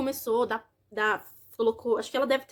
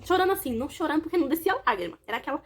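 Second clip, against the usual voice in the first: ordinary talk, nothing marked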